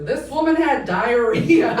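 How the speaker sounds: big, slow, booming report; as loud as possible. Retold or imitated. Cop on the phone reporting grandma's death, very loud. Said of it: "as loud as he can".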